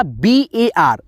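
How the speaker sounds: slow teacher spelling. Shown as a demonstration. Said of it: "जैसे यहाँ पे देखिए बी".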